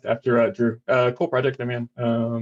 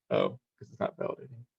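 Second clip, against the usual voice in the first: muttering to himself; 10 dB quieter, faster than usual. Real, not acted. Muttering at screen while demo fails.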